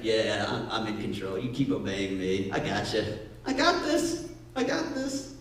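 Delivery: low, soft and reassuring; I got this, calmly; calm God. Retold or imitated. God reassuring the sinking believer, low and calm. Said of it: "And God's like".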